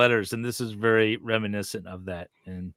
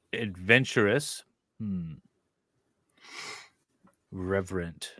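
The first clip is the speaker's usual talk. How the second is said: normal talking voice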